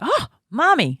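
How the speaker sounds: put-on high-pitched child's gasp of surprise; startled toddler. Toddler caught in timeout spots mommy, startled. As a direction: imitate a little one caught out.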